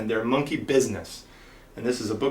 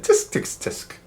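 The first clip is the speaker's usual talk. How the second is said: whispered tutting clicks; tsk tsk tsk; slower than usual. Acted. Monkeys tutting back at the peddler, whispered. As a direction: tut back mockingly.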